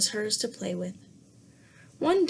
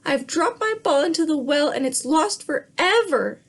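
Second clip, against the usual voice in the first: high, loud wail over the lost ball; 6 semitones higher, 5 dB louder than usual. Acted. Princess wails to the frog about her ball, loud and high. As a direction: cry it out like a spoiled child. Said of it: "she wailed".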